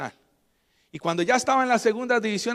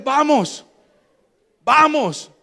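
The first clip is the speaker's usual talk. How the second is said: urgent call; 7 semitones higher, 8 dB louder, much slower than usual. Retold or imitated. Rushing to the church.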